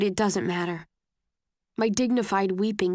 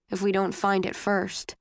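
usual speaking voice

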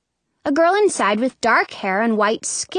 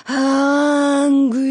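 long, higher zombie moan; 4 semitones higher, 3 dB louder than usual. A narrator's reading. Brother pretending to be a zombie, moaning. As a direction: drag the word out like the undead. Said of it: "He pretends to be a zombie".

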